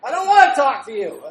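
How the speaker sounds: very loud, high comic outburst; hammed-up exasperated bellow; acted shouted refusal. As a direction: yell your inner protest for laughs. Acts out his inner refusal.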